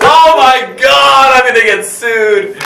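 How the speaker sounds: loud, high, drawn-out wail; slow, shouted comic despair; mock panic. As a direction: wail it in hammed-up dread. Joking dread of being sued.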